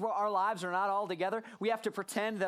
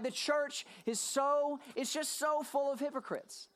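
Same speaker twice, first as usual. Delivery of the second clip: mocking complainer; 6 semitones higher, slower than usual. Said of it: "People say".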